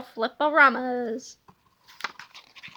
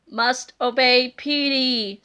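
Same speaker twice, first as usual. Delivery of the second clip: slow, low zombie monotone; zombie drone; 4 semitones lower, slower than usual. Acted. Zombie cops murmur.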